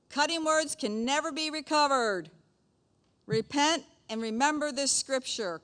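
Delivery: high, measured reading-aloud voice; scripture reading. Acted. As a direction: read it out deliberately, stretching key words. Reads proverbs and lesson aloud.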